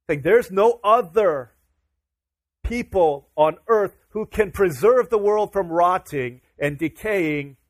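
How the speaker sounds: loud, deliberate sermon climax; full-force preaching. Acted. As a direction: hammer it home to the congregation.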